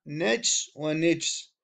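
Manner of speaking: نجس said slowly as nejs then nijs, jim without a vowel; drawn out نجس ونجس with sukun on the jim; silent jim, slowly. Shown as a demonstration. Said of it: "وسكونها". (نجس ونجس بسكون الجيم ببطء)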